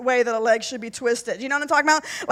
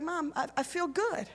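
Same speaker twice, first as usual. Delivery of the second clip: put-on much softer, a boy's small voice; young son; 12 dB quieter than usual. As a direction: voice the little boy gently, much softer. Son speaks in retold story.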